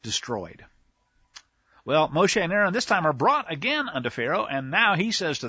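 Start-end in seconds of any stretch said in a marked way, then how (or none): 0.0-0.6 quiet emphasis. Shown as a demonstration. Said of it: "That's the first time".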